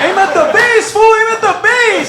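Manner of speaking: loud, high shouted orders. Retold or imitated. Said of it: "they're like".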